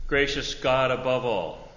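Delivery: ordinary talk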